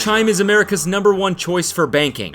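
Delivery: upbeat sponsor read. Acted. Host opening the sponsor spot, bright ad-read voice. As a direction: sell it like a radio ad.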